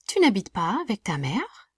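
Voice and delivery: "Tu n'habites pas avec ta mère" ending with a lift on "mère"; rising question intonation. Shown as a demonstration. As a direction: raise "mère" at the end to ask. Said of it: "make my voice go up at the end".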